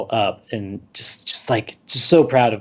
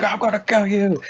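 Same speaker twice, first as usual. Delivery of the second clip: mock threat; 9 semitones higher than usual. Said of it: "the rabbi's like".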